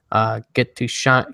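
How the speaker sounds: ordinary delivery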